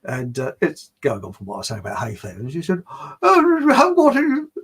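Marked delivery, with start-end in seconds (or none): 3.2-4.5 put-on high, loud botched New Zealand accent; mock a snotty policewoman in bad New Zealand accent; abandoned attempt at a New Zealand voice; failed New Zealand accent. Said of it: "I can't do a New Zealand accent".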